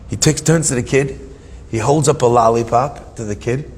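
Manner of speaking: normal talking voice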